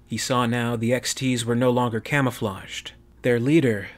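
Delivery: plain reading voice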